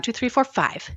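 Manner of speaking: usual speaking voice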